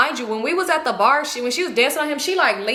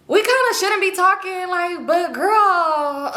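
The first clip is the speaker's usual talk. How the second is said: reluctant admission; 6 semitones higher, much slower than usual. Retold or imitated. Friend reluctantly explains, retold.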